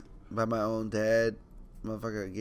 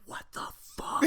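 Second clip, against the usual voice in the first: voiceless whispered mock shock; mouthed in shock; 4 dB quieter than usual. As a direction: act out silently mouthing it, only breath. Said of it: "I mouth like".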